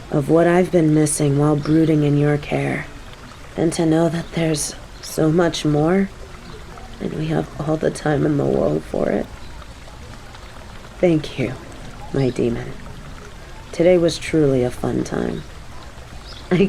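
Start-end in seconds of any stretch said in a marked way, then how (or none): 3.6-12.7 gentle loving murmur; tender. Acted. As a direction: speak close and sincere. Tender date confession.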